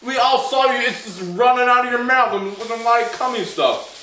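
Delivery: high, loud taunting; loud mocking. Real, not acted. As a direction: jeer at him loudly. Mocking friend who spat.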